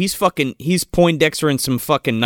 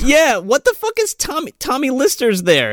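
sudden high-pitched disbelief; blurting out who just showed up on screen; surprised recognition; 11 semitones higher than usual. Real, not acted. Friend recapping a movie, startled by a cameo.

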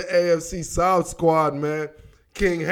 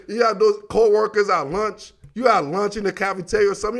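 ordinary delivery

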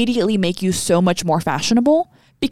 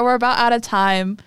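ordinary talk, nothing marked

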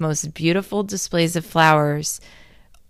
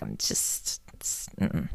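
breathless whisper, trailing to a hum; whispered dread; 15 dB quieter, slower than usual. Retold or imitated. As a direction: whisper it, unable to finish. Retold dread of going home.

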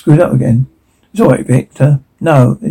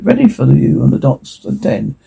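usual speaking voice

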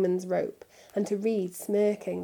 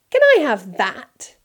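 put-on higher, louder spoiled boy cutting in; a bratty boy grabbing attention; eager Draco; 5 semitones higher, 10 dB louder than usual. A narrator's reading. Draco interrupting the adults, eager and loud. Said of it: "interrupted Draco".

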